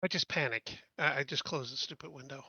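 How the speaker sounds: ordinary talk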